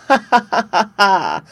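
high bright tinkling ha-ha-ha, loud; an impish sprite laughing. A narrator's reading. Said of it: "laughed a tinkling voice".

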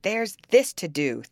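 booming furious answer; thundering. A narrator's reading. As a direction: thunder it at him. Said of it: "thundered Heathcliff".

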